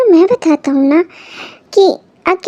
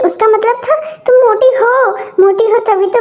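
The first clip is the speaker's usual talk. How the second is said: usual speaking voice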